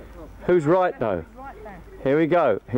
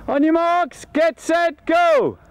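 high, loud start of a race; race starter's call; 11 semitones higher, 3 dB louder, slower than usual. Real, not acted. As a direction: call the race off, building to a big GO.

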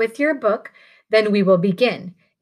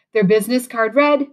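ordinary delivery

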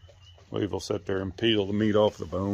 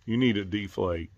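usual speaking voice

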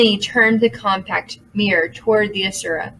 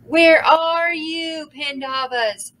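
high, slow sung call; singsong demon call; 7 semitones higher, slower than usual. A narrator's reading. Demon searches the room, singsong call. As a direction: sing it out, hunting. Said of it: "sang the Asura".

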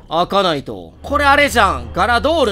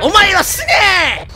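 high shouted villain dub; mock villain shout; 11 semitones higher, 4 dB louder than usual. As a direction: bellow it like the villain. Dubbing the villain's line.

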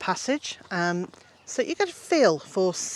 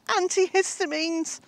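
urgent shout; 11 semitones higher than usual. Retold or imitated. Phoning Mister J at the house, a high, urgent call for help. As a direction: call out for help, much higher. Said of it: "just shouted".

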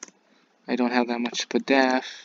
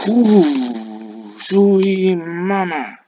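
drawn-out exclamation; 4 semitones higher, 8 dB louder, much slower than usual. Real, not acted. Exclaiming at the move.

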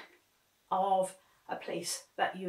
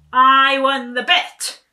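even louder, slower victory cry; triumphant shout; 6 semitones higher, 20 dB louder, slower than usual. A narrator's reading. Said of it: "he shouted".

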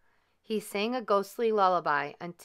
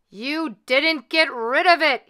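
loud, higher, slow angry shout; shouted accusation; 7 semitones higher, 10 dB louder than usual. A narrator's reading. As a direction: bellow it at him. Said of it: "he shouted".

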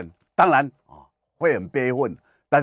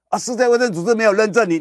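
much higher, louder, stern refusal; mock Japanese official; 12 semitones higher, 7 dB louder, faster than usual. Japan loudly telling the vaccine maker no certification. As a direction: play Japan rebuffing the lobbyists loudly. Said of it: "李秉颖说".

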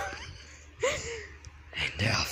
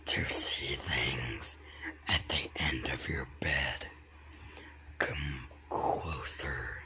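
creepy whisper; 8 semitones lower, 7 dB quieter than usual. Acted. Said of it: "Doing that voice".

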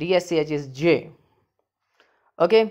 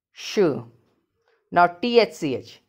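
ordinary talk

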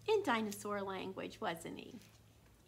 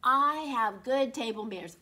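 louder proud dinosaur; dinosaur's voice; 9 dB louder than usual. A narrator's reading. Reads the dinosaur's first-person lines.

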